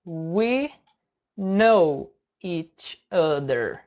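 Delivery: very slow, pause after every word; word-by-word model. Shown as a demonstration. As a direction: break it up slowly for learners. Teacher breaks phrase word by word. (bem devagar, palavra por palavra)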